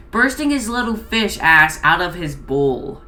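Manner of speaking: normal talking voice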